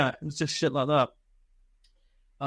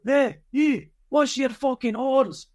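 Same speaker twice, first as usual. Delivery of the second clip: high, loud, slow barked command across the yard; bellowed order; 11 semitones higher, 4 dB louder, slower than usual. Retold or imitated. Sergeant major yelling at a soldier: loud, high, slow shout.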